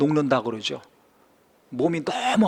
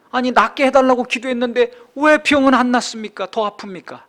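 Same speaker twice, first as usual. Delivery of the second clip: louder, higher complaint to God; frustrated pleading prayer; 6 semitones higher, 5 dB louder than usual. Acted. Believer demanding answers from God in prayer, frustrated pleading.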